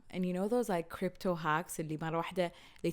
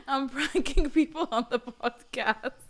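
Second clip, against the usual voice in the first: high, laughing through words; giggling, slow; 6 semitones higher, 5 dB louder than usual. Real, not acted. Laughing after prank.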